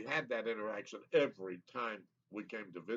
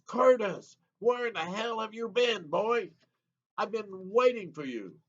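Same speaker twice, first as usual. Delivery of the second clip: high, booming gruff greeting; loud gruff uncle; 8 semitones higher, 5 dB louder than usual. Retold or imitated. Uncle running out to greet the visiting boy, loud and gruff. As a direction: holler it gruffly like an overbearing uncle. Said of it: "say in a very loud and gruff voice".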